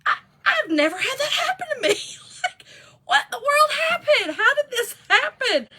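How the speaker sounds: high teary giggling disbelief; laughing quote. Retold or imitated. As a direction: squeal it out in tearful laughter. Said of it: "And I was like".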